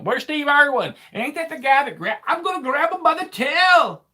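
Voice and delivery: high, loud Aussie wildlife-host impression; mock Australian accent. Playing Steve Irwin grabbing a critter, mock Australian accent. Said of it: "That is the wrong accent".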